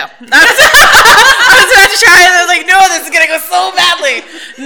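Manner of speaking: high, loud, laughing admission. Real, not acted. Admits to co-host the British accent would fail, laughing. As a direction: crack up while confessing, pitch way up.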